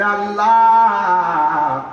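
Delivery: long, drawn-out imploring call; calling on God. Acted. Musa begs God to show himself, calling out. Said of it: "Musa নবী আল্লাহরে করছে".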